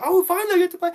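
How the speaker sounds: high, loud delighted shout; finally getting to play Halo, a high, loud excited shout. Retold or imitated. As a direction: burst out with a kid's joy. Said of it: "I was like".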